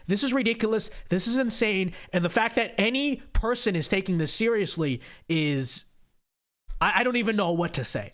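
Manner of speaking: ordinary talk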